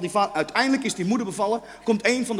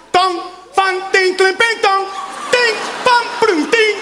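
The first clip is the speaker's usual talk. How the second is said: high, loud tumbling clatter of syllables; ringing metallic crash in nonsense syllables; clanging wok imitation; 8 semitones higher, 4 dB louder, slower than usual. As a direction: mimic a wok bouncing across the floor. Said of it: "Nou, dat geluid, zo heet dat kind".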